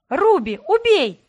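screamed command. Acted. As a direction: scream for the demon to kill. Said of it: "закричала я".

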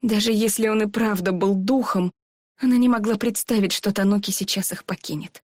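ordinary delivery, nothing marked

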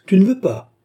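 plus haut et plus fort, menaçant; menace. Acted. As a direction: gronder le récalcitrant, plus fort. Grimbaud menace, se fâche. (le gardien menace Mathias, haut et fort)

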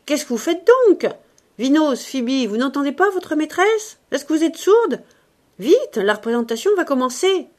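high, loud, impatient orders; shouted scolding. A narrator's reading. Ursus scolding the maids before the show, shouting. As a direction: shout at the servants to hurry. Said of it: "cria-t-il".

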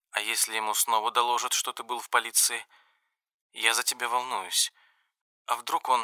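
softly voiced worried girlfriend; soft young woman voice. A narrator's reading. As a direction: speak gently, half under breath. (тихий голос встревоженной девушки)

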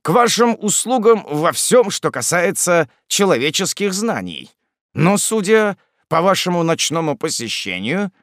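put-on obliging old morgue keeper. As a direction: play a servile scholar receiving a night visitor. Смотритель услужливо принимает гостя. (услужливый старый смотритель, повыше голосом)

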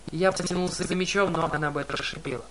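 ordinary delivery

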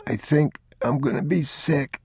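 put-on a small boy feeling ill; sick boy.

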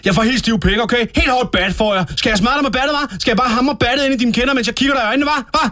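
worked-up threat, climbing in pitch; provoked outburst. Acted. Guest provoked by the interviewer's suggestion, heated threatening. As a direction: build from offence into heated, high-pitched threats.

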